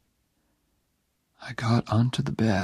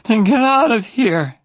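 high, much louder angry outburst; 13 semitones higher, 10 dB louder than usual. Acted. Dying Jenny angrily sends Oliver away, loud and high. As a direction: lash out at him, high and loud. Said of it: "she said angrily".

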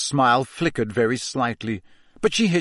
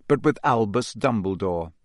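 plain reading voice

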